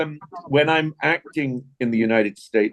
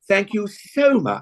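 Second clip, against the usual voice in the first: slow, higher, gushing English thanks; exaggerated English accent; 7 semitones higher, slower than usual. Shown as a demonstration. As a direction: overdo the English accent, drawing out "so". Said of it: "imitating my English accent".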